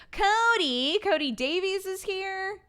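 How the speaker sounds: high, drawn-out delighted name call; real thrilled surprise; excited welcome. Real, not acted. Excited at a viewer turning up.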